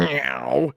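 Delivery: drawn-out imitated cartoon meow; Mario. Imitating the game hero climbing a ledge, drawn-out meow. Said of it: "Mario in Super Mario Galaxy occasionally goes".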